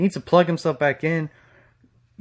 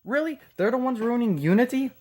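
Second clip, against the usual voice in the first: sarcastic disbelief; 6 semitones higher than usual. Real, not acted. Sarcastic reaction to clip.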